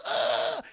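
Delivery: loud strangled grunt of pain. Acted. Kingpin's knees snap under the kick, pained grunt.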